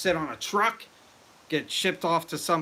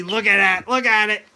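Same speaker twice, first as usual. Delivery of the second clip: excited; 5 semitones higher, 8 dB louder than usual. Real, not acted. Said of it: "So excited".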